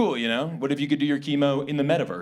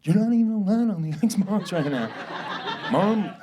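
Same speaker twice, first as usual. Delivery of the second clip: high-pitched mock sulky muttering; muttering little brother; 6 semitones higher than usual. Little brother grumbling about sharing the Xbox, mock muttering. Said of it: "just mutter to yourself".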